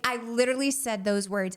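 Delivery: usual speaking voice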